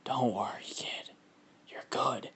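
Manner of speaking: hushed, whispery creature reassurance; Venom, sounding like Carnage. Acted. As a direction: reassure the kid in a soft monster whisper. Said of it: "Actually, that kinda sounds like Carnage".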